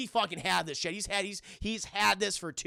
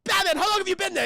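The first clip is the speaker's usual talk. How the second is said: fast, high startled shout; loud cartoon villain alarm; Joker; 13 semitones higher, 8 dB louder, faster than usual. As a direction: blurt it in mock panic. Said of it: "Joker's like".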